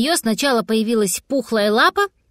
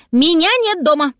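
higher, emphatic cat's announcement; announcing; 6 semitones higher, 4 dB louder than usual. A narrator's reading. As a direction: declare it loudly and shut everyone out. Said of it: "объявил кот". (громко объявляет кот)

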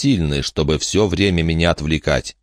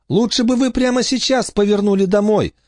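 put-on much higher childish voice; small girl pleading them home; little girl urging. Olivia urges return.

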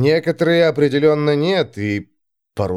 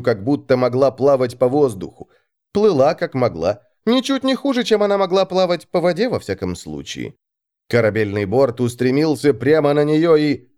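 usual speaking voice

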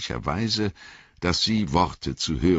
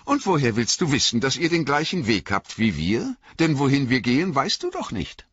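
fast, higher, louder playful taunt; teasing elves; 11 semitones higher, 5 dB louder than usual. A narrator's reading. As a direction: tease quickly and brightly. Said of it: "alberten sie".

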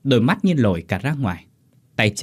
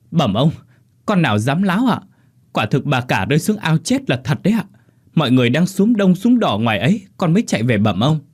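frantic servant; 5 semitones higher, 4 dB louder than usual. Acted. Servant insists.

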